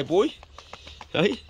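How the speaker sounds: ordinary talk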